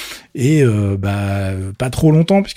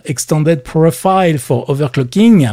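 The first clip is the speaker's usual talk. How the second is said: English accent, higher and louder, hammed up; self-mocking English accent; 6 semitones higher, 6 dB louder, slower than usual. Shown as a demonstration. Explaining the acronym to viewers in a proud English accent. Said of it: "avec mon accent anglais extraordinaire".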